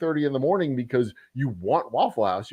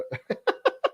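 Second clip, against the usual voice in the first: breathy laugh. Real, not acted. Laughing at own story.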